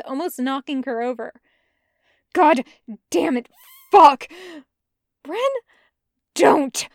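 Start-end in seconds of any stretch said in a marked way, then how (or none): 2.3-6.9 much louder, furious snarl; Bren, in pain, snarls curses at Jester. Acted. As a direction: lash out with the curses. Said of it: "he snarls at her".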